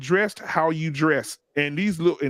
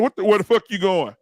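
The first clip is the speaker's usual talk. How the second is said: loud, raised-pitch comic dad outburst; higher, louder, startled challenge; Bernie Mac; 4 semitones higher, 5 dB louder than usual. Retold or imitated. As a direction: burst out like an outraged comedian dad. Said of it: "turned straight into Bernie Mac".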